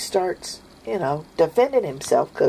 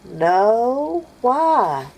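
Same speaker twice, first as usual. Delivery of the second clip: put-on child's reply; 8 semitones higher, 5 dB louder than usual. Children answering mom.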